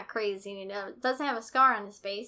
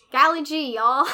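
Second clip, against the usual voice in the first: put-on wholesome 1950s kid; 4 semitones higher, 11 dB louder than usual. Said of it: "the other day he said".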